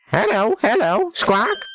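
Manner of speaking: high, squawky parrot voice; talking parrot. Acted. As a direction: screech it like a pet bird. Voicing Polly the pet parrot.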